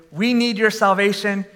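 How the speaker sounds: ordinary delivery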